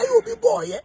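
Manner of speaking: normal talking voice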